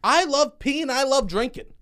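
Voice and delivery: high, loud gushing; excited. Pretend. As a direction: gush about it like a kid. Exaggerated enthusiasm, high.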